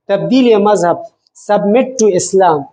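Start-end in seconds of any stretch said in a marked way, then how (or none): none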